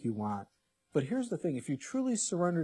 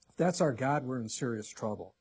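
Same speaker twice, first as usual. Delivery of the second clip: ordinary delivery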